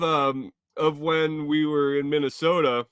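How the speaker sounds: ordinary talk, nothing marked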